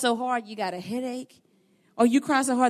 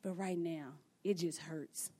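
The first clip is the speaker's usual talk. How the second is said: low, very soft, resigned hurt; acted subdued sorrow, low and hushed; pained admission; 4 semitones lower, 12 dB quieter than usual. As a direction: drop right down to a quiet confession. Voices a hurting believer's admission.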